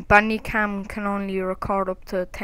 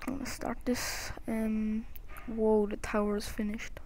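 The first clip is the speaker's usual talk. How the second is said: ordinary delivery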